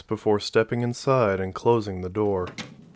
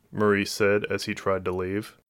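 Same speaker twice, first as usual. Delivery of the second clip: plain reading voice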